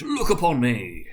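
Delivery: loud, slow and stately; slow grand command. A narrator's reading. Spirit bidding Scrooge to look, slow and loud. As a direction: let the giant spirit command attention.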